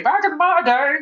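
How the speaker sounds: mock a little old lady reminiscing. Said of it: "I sound like an old lady".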